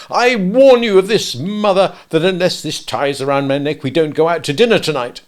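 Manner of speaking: loud, stern escalating threats; sternly. Acted. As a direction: lay down the law to his wife. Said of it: "he went on sternly".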